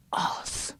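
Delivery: breathless hushed whisper; hushed hopeful whisper. Acted. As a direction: whisper it like a secret spell.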